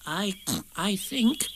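soft, high, hesitant piglet; nervous. A narrator's reading. Piglet venturing a word to angry Eeyore, nervous. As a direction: begin timidly, slow and halting. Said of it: "began Piglet nervously".

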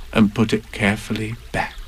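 usual speaking voice

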